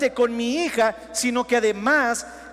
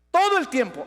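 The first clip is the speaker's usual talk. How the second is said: "todo el tiempo" repeated higher and louder, "todo" hit hardest; emphatic repeat; 4 semitones higher, 5 dB louder than usual. Real, not acted. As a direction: land "todo" with a strong push. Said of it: "Me está viendo Dios todo el tiempo".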